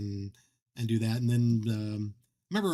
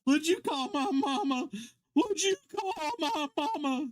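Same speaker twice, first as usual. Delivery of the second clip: much higher, put-on voice of an offended man with a knife; 13 semitones higher than usual. Man chasing him round a table, offended by the insult: higher put-on menace.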